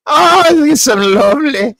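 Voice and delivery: high, loud, swooning posh-ish voice, far from his own; Papa Tarly impression. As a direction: gush over it in a high fluttery voice. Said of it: "That's my Papa Tarly impression".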